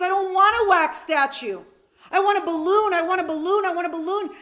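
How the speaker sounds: put-on high, loud whiny child begging; a five-year-old throwing a tantrum over a balloon; whining little boy. Little boy at the zoo whining to his dad, high and loud.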